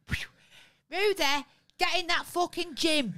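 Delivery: loud, nagging impression of the missus; impression of Sarah. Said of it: "That's my impression of Sarah".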